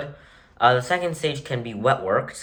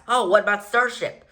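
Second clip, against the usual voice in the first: imagined questioner; 9 semitones higher than usual. Retold or imitated. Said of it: "you might ask".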